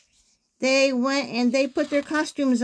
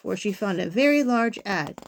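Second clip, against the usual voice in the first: usual speaking voice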